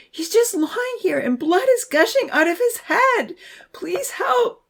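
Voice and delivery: high-pitched distraught plea; panicked, high cry for help. A narrator's reading. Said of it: "someone was crying".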